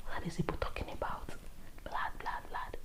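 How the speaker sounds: whispered confusion to herself; puzzled whisper. Retold or imitated. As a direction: whisper it, baffled. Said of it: "I was like".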